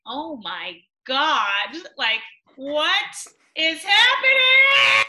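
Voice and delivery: slow, high, loud stunned cry; overwhelmed disbelief. Retold or imitated. Meeting Paris for the first time, slow loud stunned cry. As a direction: act out being floored by a crush.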